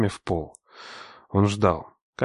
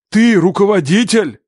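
much higher, louder, slow yell; shout; 11 semitones higher, 7 dB louder than usual. A narrator's reading. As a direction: hammer it in as accusation. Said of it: "крикнул он".

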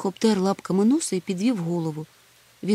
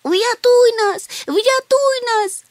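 very high, loud, drawn-out cry for help; sobbing plea; 8 dB louder, much slower than usual. A narrator's reading. Chmykh begging Hemul to save them, wailing. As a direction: wail desperately for rescue. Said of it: "ридав Чмих".

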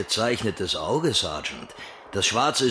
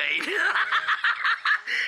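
cackling laugh. Acted. Caller laughing at his own joke, high cackle. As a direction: cackle over the punchline.

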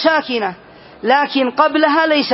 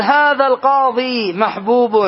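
slow example. Shown as a demonstration. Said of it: "فتقول".

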